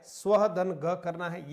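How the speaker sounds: स्वह धन ग said slowly and firmly; slow, stressed exception; slow emphasis. Shown as a demonstration. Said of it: "ये थोड़ा अपवाद स्वरूप है".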